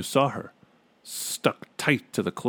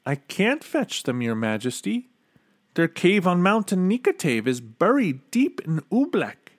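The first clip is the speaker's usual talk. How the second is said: lightly voiced, higher-pitched Bartholomew; young servant's reply; 7 semitones higher than usual. A narrator's reading. Servant tells king the magicians are unreachable, lightly protesting. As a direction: explain helplessly to your king.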